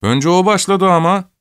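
put-on high, agitated boy's protest; excited shout. A narrator's reading. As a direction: call it out in self-defence. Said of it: "diye bağırdı kırmızı gömlekli bir çocuk heyecanla".

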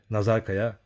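ordinary delivery, nothing marked

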